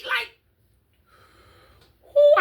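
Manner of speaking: high, loud disbelieving who; incredulous cry. Real, not acted. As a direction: shriek it in disbelief.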